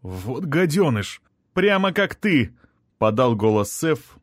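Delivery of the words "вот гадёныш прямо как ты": raised, indignant outburst; scornful retort in a higher voice; indignant insult. A narrator's reading. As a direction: spit the insult, pitch climbing high. Prince blurts insult at traitor.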